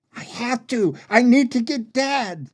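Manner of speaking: high, urgent cry; anguished insistence. Acted. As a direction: insist desperately while having to leave. Brother answers pleading sister.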